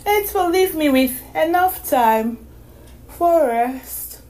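put-on high girl voice, thinking aloud; a girl laying out her plans; young girl voice. A narrator's reading.

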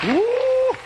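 high, held "woo" whoop; joyful whoop. Retold or imitated. Preacher seeing the man's life spared, joyful whoop. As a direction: let out a high holy whoop.

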